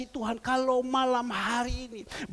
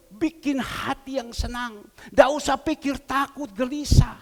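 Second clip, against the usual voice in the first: ordinary talk, nothing marked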